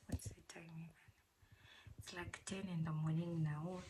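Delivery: hushed, breathy ASMR-style whisper; soft whisper. Acted. Whispering to viewers while unboxing groceries, soft hush. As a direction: whisper softly as if not to wake anyone.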